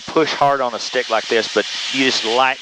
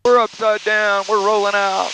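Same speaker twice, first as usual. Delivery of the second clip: on a roller coaster; 6 semitones higher than usual. Real, not acted. Barrel roll in progress.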